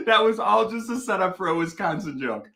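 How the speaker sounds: ordinary talk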